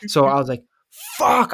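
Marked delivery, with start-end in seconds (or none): 1.2-1.5 high-pitched panicked yell; retold scream. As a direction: pitch leaps up on a horrified yelp. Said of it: "I literally screamed".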